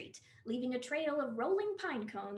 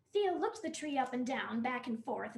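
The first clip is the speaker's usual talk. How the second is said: plain reading voice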